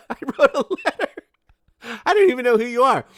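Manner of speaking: very high, rapid, loud protest; mock bewilderment. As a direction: squeal it like a comically baffled stranger. Imagines the baffled man's reply.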